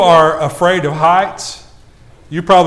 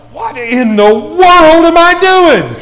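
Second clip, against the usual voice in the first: high, loud, drawn-out disbelief with a laugh; a half-laughing gasp at your own folly; mock alarm; 9 semitones higher, 5 dB louder, slower than usual. Telling the congregation his roller coaster panic, slow laughing disbelief. Retold panic at top of ride.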